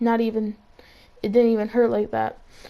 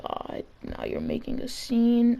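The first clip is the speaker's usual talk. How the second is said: exasperated mutter; 5 dB quieter than usual. Retold or imitated. Exasperated aside.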